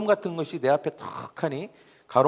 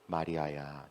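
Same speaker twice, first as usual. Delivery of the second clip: low, gentle calling of a name; tender; 12 semitones lower, 14 dB quieter, slower than usual. Acted. Preacher as Jesus calling Mary at the tomb, tender and low. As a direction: call her softly in a low voice. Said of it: "정말 다정하고".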